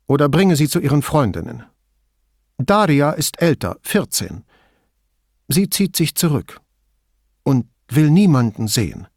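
terse middle-aged woman, wary; Signora Toso voice. A narrator's reading. Toso speaks.

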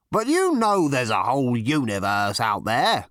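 frustrated, emphatic protest; exasperated cry. A narrator's reading. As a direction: cry it out in disbelief. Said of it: "cried Zarniwoop".

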